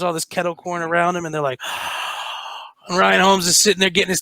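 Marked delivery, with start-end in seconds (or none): 1.6-2.7 long voiceless gasp, acted out; imitated shocked gasp. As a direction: suck in air as a startled cop would. Imitates police finding the body.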